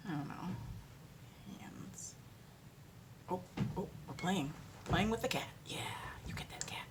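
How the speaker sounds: whispered to the cat; hushed aside. Real, not acted. Speaker whispers while playing with the cat, hushed. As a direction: murmur it off-mic.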